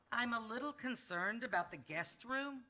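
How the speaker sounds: usual speaking voice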